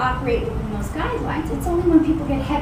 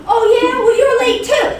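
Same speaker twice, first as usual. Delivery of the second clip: higher and louder indignant retort; defensive retort; 9 semitones higher, 7 dB louder than usual. Acted. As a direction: snap back with the same accusation. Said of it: "You think they're gonna go".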